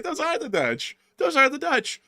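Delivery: very high squeaky insistence; high, mocking, insistent caricature; mock indignant know-it-all. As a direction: repeat it like an outraged fool. Imitating aggressive correctors.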